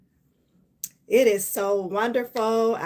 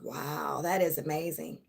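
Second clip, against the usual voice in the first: low, soft, deadpan wow that is amazing; mock amazed calm reply; 5 semitones lower, 9 dB quieter than usual. Telling viewers how she answered a tested boundary, low and soft. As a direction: understate it, refusing to get upset. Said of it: "That's what I said".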